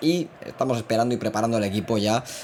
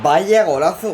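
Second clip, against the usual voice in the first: excited shout; 7 semitones higher, 7 dB louder, slower than usual. Real, not acted. Reacts to a wonder goal.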